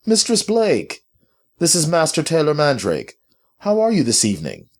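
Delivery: high, projected formal greeting; authoritative. A narrator's reading. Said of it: "maintained an air of authority".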